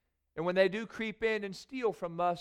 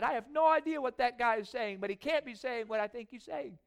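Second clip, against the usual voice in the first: higher, puzzled listener wondering aloud; confused crowd member; 6 semitones higher than usual. Retold or imitated. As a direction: sound baffled by Jesus' words. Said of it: "plays confused listener in crowd".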